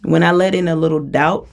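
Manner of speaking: usual speaking voice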